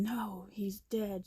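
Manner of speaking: ordinary talk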